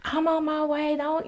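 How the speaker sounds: mother hurrying to get off the phone; hurried. Retold or imitated. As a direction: brush him off quickly. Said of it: "she's like".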